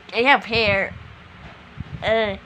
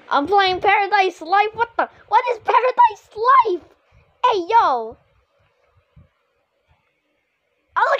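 high squeaky voice; 8 semitones higher than usual. Acted. Reacting in a high voice.

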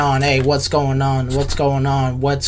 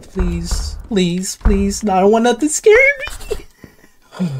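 frantic begging; 6 semitones higher than usual. Real, not acted. Player pleading with the game, high panicked begging. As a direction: beg in a rising panic.

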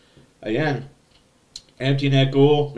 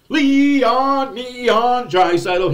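higher, louder, drawn-out call of the scorer's name; excited goal call; 9 semitones higher, 5 dB louder, much slower than usual. Acted. Fan recapping a goal, calling out the scorer in excitement. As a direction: hype up Leon like a goal horn.

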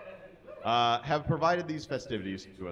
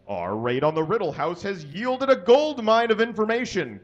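gushing, higher and louder; 6 semitones higher, 5 dB louder than usual. Acted. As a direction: spill it out eagerly as Hermione. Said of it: "gushed Hermione".

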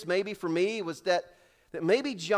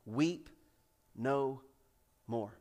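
soft and tender, weep no more; tender repetition; 7 semitones lower, 8 dB quieter, much slower than usual. Real, not acted. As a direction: savour each word gently. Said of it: "three of the most beautiful words".

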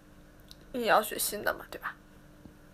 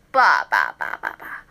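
mock splutter noise; 5 dB louder than usual. Imitating snot flying.

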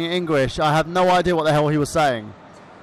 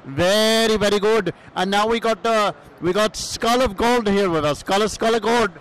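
put-on Iranian cousin accent. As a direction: play the foreign cousin with a thick accent. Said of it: "Michael Burhan's Iranian cousin".

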